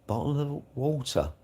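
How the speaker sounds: "bottle of water" in the speaker's own accent; says it on request. Shown as a demonstration. Streamer obliging a viewer who wants to hear "bottle of water", said on request. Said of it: "why you'd want me to say that".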